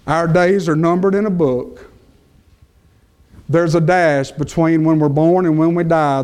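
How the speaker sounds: ordinary delivery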